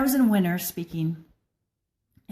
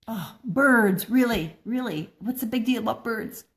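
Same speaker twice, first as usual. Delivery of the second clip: imitating her bored younger self, scoffing at birds; mock teenage scoff; 2 semitones higher than usual. Teen daughter scoffing at parents' birdwatching, mock dismissive. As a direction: roll your eyes at the birds, half-joking.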